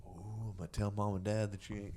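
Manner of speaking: normal talking voice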